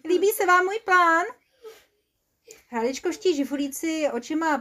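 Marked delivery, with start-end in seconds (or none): 0.1-1.3 high, loud boastful voice; cocky inventor pitching his idea; proud schemer. Acted. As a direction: show off your plan, pitched up. Boastful character pitches his plan.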